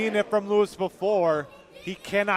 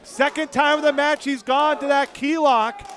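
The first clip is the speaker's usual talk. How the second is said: raised play-by-play call; wrestling commentator; 7 semitones higher, 5 dB louder than usual. Real, not acted. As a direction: keep the call pumped. Live play-by-play.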